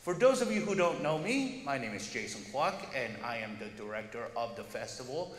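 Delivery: ordinary delivery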